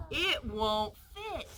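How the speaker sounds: slow, playful, with a giggle; giggling. Acted. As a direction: tease him with a little laugh. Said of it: "Tessa giggled".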